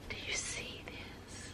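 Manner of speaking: voiceless whisper, slow and awed. Real, not acted. Showing viewers a surprise Rorschach pattern, whispered. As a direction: hush it like you found a secret.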